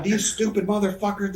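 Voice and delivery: high, indignant Southern rant; mock yell with a Southern twang; Southern-accented yelling. Wrestler ranting about the match, yelling in a Southern accent. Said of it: "so I'm yelling".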